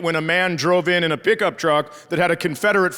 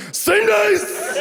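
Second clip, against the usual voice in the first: high, drawn-out holler; mock yell; 12 semitones higher than usual. Gambler calling out as the dice roll, yelled. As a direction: holler it high across the table. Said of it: "I yelled".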